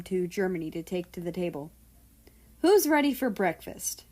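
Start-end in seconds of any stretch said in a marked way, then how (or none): 2.6-4.0 high, loud cheerful call; sing-song breakfast call; chirpy. Acted. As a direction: chirp it out brightly to the whole family. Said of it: "Nazi chirped".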